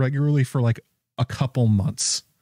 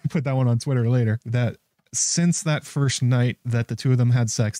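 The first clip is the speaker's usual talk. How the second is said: usual speaking voice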